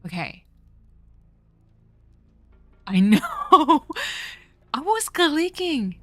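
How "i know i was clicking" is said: high laughing complaint; real exasperated laughter; frustrated laugh. Real, not acted.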